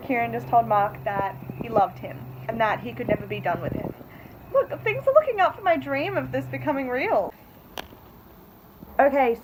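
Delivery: ordinary delivery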